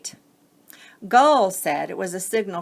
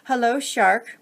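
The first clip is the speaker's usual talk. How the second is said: calling out; 5 semitones higher, 6 dB louder than usual. Acted. Buoy calls to the shark.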